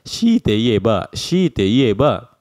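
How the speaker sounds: very slow, dragged out shite ieba; reluctant slow. Shown as a demonstration. Teacher showing forced reluctant speech. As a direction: drawl it out as if forced to speak. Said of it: "Lihat deh contohnya".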